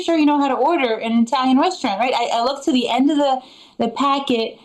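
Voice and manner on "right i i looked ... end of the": ordinary delivery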